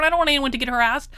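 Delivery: ordinary delivery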